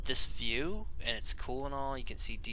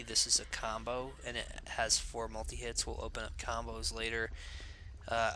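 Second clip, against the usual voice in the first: ordinary talk, nothing marked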